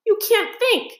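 high, shaky frightened mole voice. A narrator's reading. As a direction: still trembling from the dark wood. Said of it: "exhausted and still trembling".

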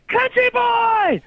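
high, loud, slow yelled nickname. Retold or imitated. Old friend from Skid Row spots him at work, yelling. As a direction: call it out across the store. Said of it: "and she yelled".

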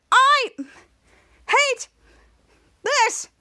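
high, loud mock scream stretched over each word. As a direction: scream it while banging on the glass. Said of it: "Captain Man was screaming".

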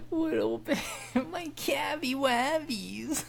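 put-on high cooing baby talk, laughing; high, slowed baby voice with a laugh. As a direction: coo at him like a baby. Teasing about calves, baby talk.

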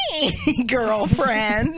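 put-on high, drawn-out girlfriend hello; mock gushy greeting. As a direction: stretch the hello in a singsong, fake-chummy way. Imagined gushy text greeting to Nick.

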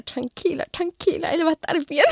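calm her down kindly; soothing kiosk lady. Retold or imitated. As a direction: kiosk lady comforting a crying girl, softly.

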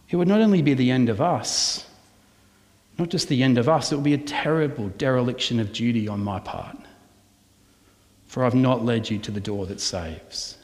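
ordinary delivery

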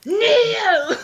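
high, loud commanding Loki; dark lord. Shown as a demonstration. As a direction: command them to kneel. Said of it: "I could say it again".

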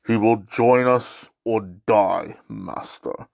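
low, slow Vader imitation; a hint of a menacing Darth Vader, low and slow; lightly voiced Vader. Vader answering the Emperor.

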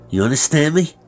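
high, loud threatening question; sharp demand. Acted. Master making sure the boy obeys, threatening. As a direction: demand an answer, voice leaping up.

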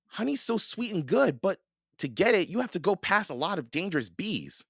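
put-on high-pitched girl puzzling it out; musing girl Annie. A narrator's reading. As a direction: reason it out in a high child's voice. Narrator voices Annie.

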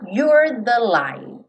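You're with the tongue curled on the R, very slow. Shown as a demonstration. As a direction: roll the R back in You're. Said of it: "língua enrolada no R".

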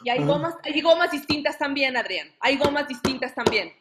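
gritando más fuerte y agudo; revelación gritada. Acted. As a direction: anunciarlo como tragedia. Dramatic revelation, shouting. (revelación a gritos)

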